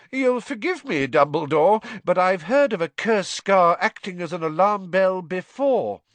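high, stubborn and dismissive; stubborn minister. A narrator's reading. Fudge stubbornly dismissing Dumbledore, high prim voice. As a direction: brush him off politely.

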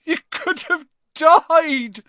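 loud, high, slow wail; sobbing. A narrator's reading. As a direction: wail it in anguish, stretching the words. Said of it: "sobbed Hagrid".

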